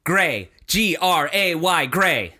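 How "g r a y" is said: G R A Y, each letter said separately and slowly; spelling it out. Shown as a demonstration. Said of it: "spelling the word aloud".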